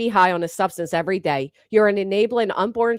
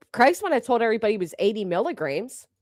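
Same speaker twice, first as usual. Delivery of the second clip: ordinary talk, nothing marked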